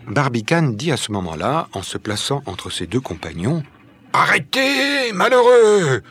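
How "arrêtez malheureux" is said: high, loud, drawn-out cry; urgent shout. A narrator's reading. Barbicane steps between his companions, shouting urgently. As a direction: break up the fight with a shout.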